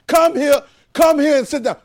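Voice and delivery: high, loud shouted command; loud volume. Shown as a demonstration. Preacher showing the class how volume changes, shouting. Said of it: "But the volume changed".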